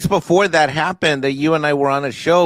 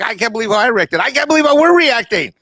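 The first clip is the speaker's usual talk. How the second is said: higher, louder excited disbelief; growing amazement; 9 semitones higher, 4 dB louder than usual. Retold or imitated. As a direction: pitch climbing higher into disbelief. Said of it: "I'm like".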